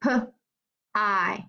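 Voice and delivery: "pie" split into P and long I; pie sounded out. Shown as a demonstration. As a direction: pause between P and the vowel of "pie". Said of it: "Let's listen to the I in these words".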